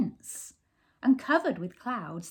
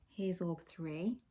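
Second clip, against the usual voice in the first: ordinary delivery, nothing marked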